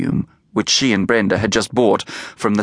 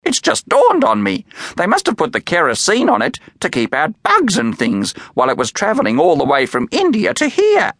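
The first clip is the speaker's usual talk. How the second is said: excited squawk; 9 semitones higher than usual. A narrator's reading. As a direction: burst out with a sudden idea. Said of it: "Doris screeched excitedly".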